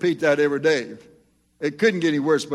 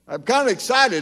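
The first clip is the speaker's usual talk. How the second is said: light, higher voice of the pastor; mock excited pastor; 8 semitones higher, 3 dB louder than usual. Preacher imitating a pastor who is excited, light. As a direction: play up his eagerness a little.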